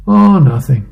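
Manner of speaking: loud, sulky "Oh, nothing". Acted. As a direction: say it with a pout. Wife's sulky reply.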